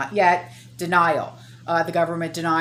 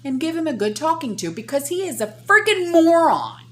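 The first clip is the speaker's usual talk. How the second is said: angry; 9 semitones higher, 4 dB louder than usual. Real, not acted. Insulting the official.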